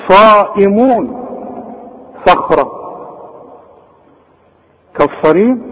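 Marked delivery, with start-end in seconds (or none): 0.1-1.2 صائمون slowly, with a heavy emphatic ص; emphatic saad. Shown as a demonstration. Teacher corrects to heavy ص in Quran recitation. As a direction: draw out the thick ص in صائمون. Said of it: "لا،".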